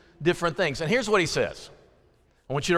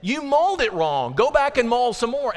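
much higher, pressing "I'm not talking about bread"; exasperated Jesus; 9 semitones higher than usual. Retold or imitated. Jesus scolding disciples who missed the point, high and exasperated. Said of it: "Guys, he says".

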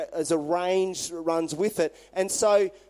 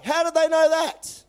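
much higher and louder, baffled fury; exasperated outburst; 10 semitones higher, 6 dB louder than usual. Retold or imitated. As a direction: throw up your hands in disbelief. King's exasperated question.

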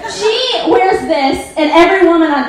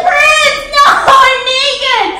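put-on higher, slow, whiny plea of a naked girl; mock naked Apollonia; 9 semitones higher, 3 dB louder, much slower than usual. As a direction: play her pleading helplessly, hammed up. Apollonia pleading, retold mock voice.

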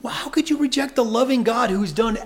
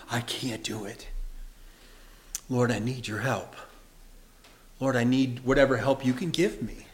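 hushed, lower prayer for help; quiet plea; 8 semitones lower, 5 dB quieter than usual. Retold or imitated. Preacher voicing a broken sinner praying quietly. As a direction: softly beg the Lord.

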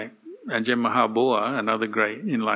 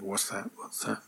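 a hushed, jumpy "What's that?", much softer; mock scared whisper; 2 semitones lower, 11 dB quieter than usual. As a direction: play a monk spooked by a noise at night, softly. Imagined fear in cremation ground.

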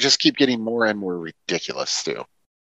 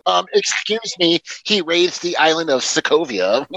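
mock pedant; 7 semitones higher than usual. Co-host correcting a superhero movie detail, mock pedantry. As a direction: correct him like a hammed-up know-it-all.

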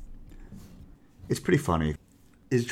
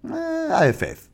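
squeaky high voice; 3 dB louder than usual. Acted. Sudden squeaky aside.

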